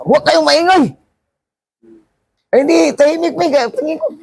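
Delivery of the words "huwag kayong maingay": angry shout. Retold or imitated. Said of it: "sumigaw na".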